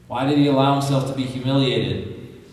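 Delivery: ordinary delivery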